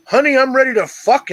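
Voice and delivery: high, emphatic acted announcement; hammed-up randy urgency; eager husband. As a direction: burst in and declare it, pitch up. Husband bursts through the door.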